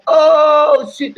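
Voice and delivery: hyped surprised yelp; acted surprise. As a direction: react like the beat just dropped.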